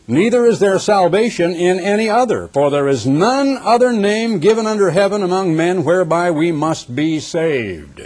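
preacher declaiming Scripture; emphatic Scripture reading. Acted. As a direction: proclaim it high, drawing out the last words. Reads Scripture aloud emphatically.

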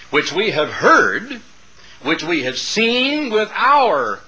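slow, emphatic Bible reading; scripture reading. Acted. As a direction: read it out slowly, weighting key words with long pauses. Reads scripture aloud to congregation.

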